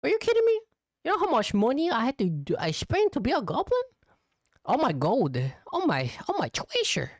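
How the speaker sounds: a greedy loot goblin clutching his gold; hammed-up high goblin whine. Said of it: "I'm like".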